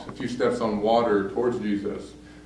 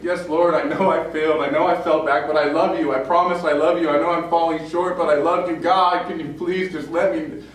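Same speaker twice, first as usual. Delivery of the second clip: higher, louder pleading confession; desperate plea; 5 semitones higher, 4 dB louder than usual. Retold or imitated. Peter pleading with Jesus after his denials. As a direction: beg him to believe you, voice rising. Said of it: "He's like".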